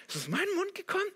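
high, quick incredulous question; squeaky-high puzzlement at yourself; startled disbelief. Acted. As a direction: ask yourself in shocked disbelief. Acts out startled self-questioning.

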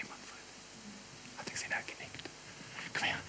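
whispering. Acted. Preacher and Manfred trading secrets, whispered throughout. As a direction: keep the whole exchange hushed and breathy-quiet. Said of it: "ihm ins Ohr flüstere".

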